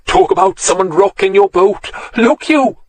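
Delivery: put-on laughing Welsh accent, high on Look you; a Welsh artist tickled by his own joke; amused Welsh lilt. A narrator's reading. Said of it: "his sing-song voice".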